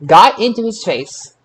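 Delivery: ordinary talk, nothing marked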